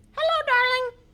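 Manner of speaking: high, loud squawky parrot. Acted. Showing kids how a parrot talks, high squawk. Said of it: "Can you speak like a parrot?".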